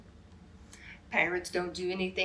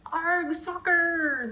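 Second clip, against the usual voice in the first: high, loud, slow pirate roar; a crew hamming up a pirate cheer; yelled; 9 semitones higher, 5 dB louder, much slower than usual. A narrator's reading. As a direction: drag out the arg like a cheering pirate. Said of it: "kicked the ball and yelled".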